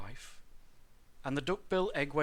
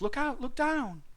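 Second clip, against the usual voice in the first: high-pitched call of warning; urgent storybook cry; yelled warning; 11 semitones higher, 4 dB louder than usual. A narrator's reading. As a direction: call out to warn them. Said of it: "who yelled".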